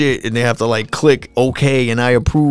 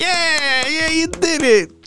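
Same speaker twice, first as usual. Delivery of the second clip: put-on much higher, slow sing-song praise for a toddler; high, drawn-out cooing to a child; baby-praise cheer; much slower than usual. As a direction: coo it like cheering a baby's first steps. People cheering a toddler's first steps.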